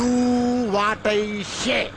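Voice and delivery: very slow, stretched English command; drawn-out order. Acted. Constable lording it over his junior, very slow drawn-out English. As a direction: drag out each word like a bossy officer.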